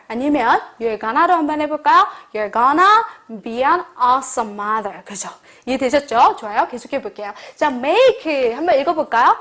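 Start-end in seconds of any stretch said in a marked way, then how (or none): 2.3-5.0 very slow, each word laid out; slow model sentence. Shown as a demonstration. Teacher modelling for the class, very slow. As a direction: say it slowly for students to copy. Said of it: "느낌을 느끼면서".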